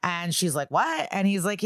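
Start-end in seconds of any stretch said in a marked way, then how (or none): 0.7-1.1 high, rising mock-shocked what; quick incredulous what. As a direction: act out her startled disbelief. Retelling her shocked reaction.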